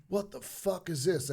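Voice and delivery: a hint of the angry sergeant; mock drill instructor.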